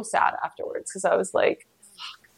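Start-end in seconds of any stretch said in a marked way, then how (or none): 1.8-2.3 barely audible, whispered swear; dejected curse. Retold or imitated. Depressed after the birth, whispering a defeated curse. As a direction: let the curse out almost silently.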